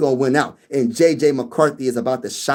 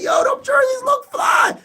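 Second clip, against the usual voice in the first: excited shout. Retold or imitated. Reliving watching the game, a high excited yell. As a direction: yell it like the jerseys just blew your mind. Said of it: "I'm like".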